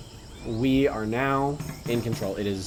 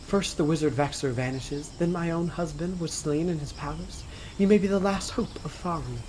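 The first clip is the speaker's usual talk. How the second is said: higher-pitched queen's speech; female voice; 5 semitones higher than usual. Acted. Reading the game's queen aloud to a co-player, female voice. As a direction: read it as a queen in a put-on female voice. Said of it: "reading it in a female voice".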